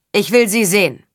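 called-out order. A narrator's reading. Nobleman wants to see the captives, calling out. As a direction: demand it from afar. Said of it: "rief der Edelmann".

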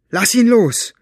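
loud, high, trembling demand; trembling outburst. A narrator's reading. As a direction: lash out with a trembling voice. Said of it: "mit zitternder Stimme an". (laut, hoch und zitternd angefahren)

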